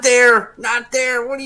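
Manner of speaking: high, loud panicked yelling; alarmed shout. Retold or imitated. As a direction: yell in alarm at a wrong aim. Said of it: "She goes".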